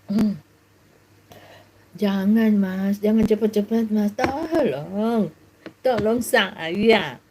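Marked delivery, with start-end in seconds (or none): 2.0-7.2 slow, drawn-out fake protest; mock pleasure plea. Shown as a demonstration. Preacher mimics a different kind of help cry, slow mock moaning. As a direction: stretch each plea as if enjoying it. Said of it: "minta tolong beda sayang".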